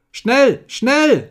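high, loud, urgent shriek; shrill cry. A narrator's reading. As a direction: shriek it out to hurry everyone along. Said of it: "mit schriller Stimme schrie".

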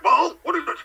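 high, loud mock grumpy neighbor; gruff old man. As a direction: bark it like a cranky old man. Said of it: "he's like".

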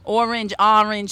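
"Orange, orange" said two ways, loud and slow; two pronunciations. Shown as a demonstration. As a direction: demonstrate both regional vowels in "orange". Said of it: "I catch myself saying".

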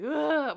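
high, loud guttural yell; imitated yell at a bear; loud grunting yell. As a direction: roar to make yourself big. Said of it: "yelling at the bear now".

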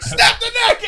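high, loud laughing yell; yelled quote. Retold or imitated. Acting out the sketch for the panel, yelling through laughter. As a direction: bellow it like a frenzied order. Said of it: "starts yelling to kill the guy".

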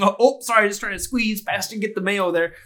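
hammed-up Midwest politeness; Midwestern accent. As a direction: play an apologetic Midwestern kobold squeezing by. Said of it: "Kobolds are from the Midwest".

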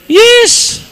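a sudden high squeak on yes; high squeaky yes. Pretend. Preacher squeaking yes way up high to the congregation. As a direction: pop the yes way up high like a choir note. High sung note about heaven.

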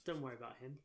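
put-on murmured aside to a kid; dismissive hushed mum. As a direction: quietly brush it off, as a mum to a child. Mum hushing her child in story.

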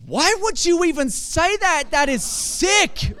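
loud, slow shocked outrage; outraged. Pretend. Imagined listener recoils at the father's words, outraged shout. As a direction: throw the disgust back at them. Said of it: "you're like".

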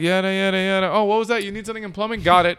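dismissive skimming. Retold or imitated. Acts out skimming a work message.

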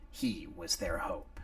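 ordinary delivery, nothing marked

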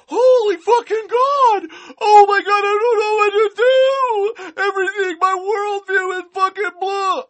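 fake-sob a hysterical freakout; mock panic. Mocking haters' panic over representation.

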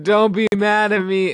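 high, loud mock begging; hammed-up pleading. Stoned teen begging mom for forgiveness, hammed-up pleading. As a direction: wail it like a kid in trouble.

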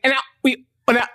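a DJ losing it as the song skips; flustered DJ. Retold or imitated. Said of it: "or I could just say".

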